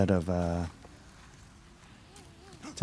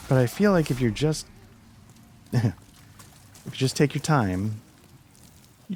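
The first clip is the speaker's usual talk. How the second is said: voice cracking; 5 semitones higher, 4 dB louder, slower than usual. Real, not acted. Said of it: "Losing my voice there for a sec".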